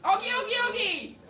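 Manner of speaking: high, loud rallying chant; rousing rugby-crowd chant; catchphrase call. Retold or imitated. Calling out a famous catchphrase.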